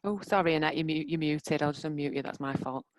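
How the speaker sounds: ordinary talk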